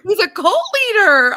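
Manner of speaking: high, loud disbelieving cry of the news; shocked exclamation. Real, not acted. Telling her cohosts, shocked and loud. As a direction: blurt it out in stunned disbelief. Shocked reveal of cult leader.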